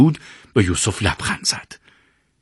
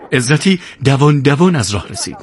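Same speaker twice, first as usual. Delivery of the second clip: ordinary delivery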